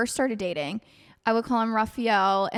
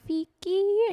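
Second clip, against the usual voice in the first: high, drawn-out pet-name call; wheedling call; 9 semitones higher than usual. Retold or imitated. Wife calls her husband when she wants something, wheedling. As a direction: call out the nickname high, angling for a favour. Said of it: "If I really want something, I'm like".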